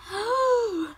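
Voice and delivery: high, delighted gasp; excited gasp. Real, not acted. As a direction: gasp in wonder at the book. Excited reaction to book cover.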